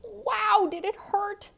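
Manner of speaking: Wow shouted high and loud; high loud exclamation; excited exclaim. A narrator's reading. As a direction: burst out for the kids at the sting. Bee stings Ferdinand.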